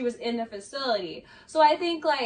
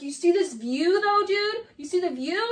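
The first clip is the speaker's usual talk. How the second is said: higher-pitched, eager imitation of a friend; excited mock gushing; 5 semitones higher, 3 dB louder than usual. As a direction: imitate someone gushing over a view. Acting out Schwartz excited about view.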